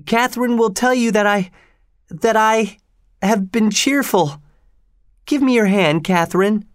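put-on high, halting boy voice; feeble, high-pitched nervous boy; frightened pleading. A narrator's reading. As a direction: stammer an appeal in a very high pitch. Weak Linton pleads before his father.

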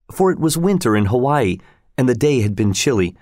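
ordinary delivery, nothing marked

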